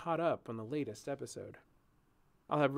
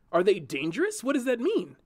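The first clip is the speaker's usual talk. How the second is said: alarmed; 7 semitones higher, 7 dB louder than usual. A narrator's reading. Julian grabs her, alarmed.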